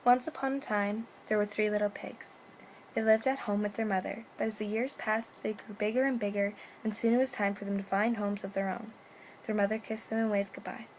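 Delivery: soft, gentle storybook reading; bedtime story narration. A narrator's reading. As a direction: tell it softly like a bedtime tale. Reads bedtime story aloud.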